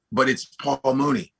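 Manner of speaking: ordinary talk, nothing marked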